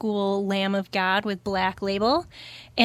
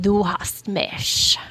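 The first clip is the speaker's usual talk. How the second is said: slow, a hint of a [name] growl; [name] imitation; much slower than usual. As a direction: drag it out like [name]. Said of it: "for some [name]".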